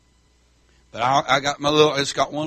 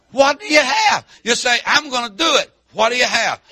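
loud, high challenge from the pulpit; full-force preaching; 7 semitones higher, 6 dB louder than usual. Acted. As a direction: throw the question at the congregation. Preaches the Lord's question.

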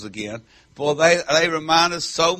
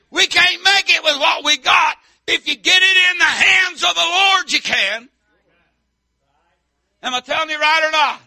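loud, raised country-preacher voice; full-force preaching; 12 semitones higher, 7 dB louder than usual. Acted. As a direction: preach it hard to the congregation.

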